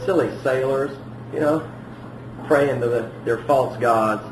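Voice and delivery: soft, dismissive scoff at the sailors; eye-rolling mockery. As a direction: roll your eyes as you say it. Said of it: "we kinda roll our eyes".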